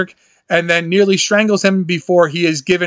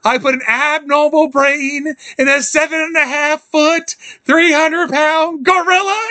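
higher, put-on voice, slowing at the end; doctor realizing the brain mix-up, raised put-on voice; quoting Frederick; 8 semitones higher than usual. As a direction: play the alarmed doctor, pitched up.

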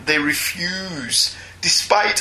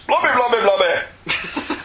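Mr Blobby voice; 6 semitones higher, slower than usual. Retold or imitated. Imitates Mr Blobby, high.

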